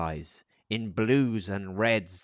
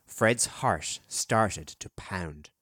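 ordinary delivery, nothing marked

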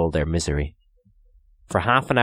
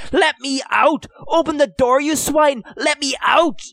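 desperate full-strength yell, much higher; 4 dB louder than usual. A narrator's reading. Trapped boy yelling at his captors through the door. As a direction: yell to be let out with everything he has. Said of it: "yelling with all his strength".

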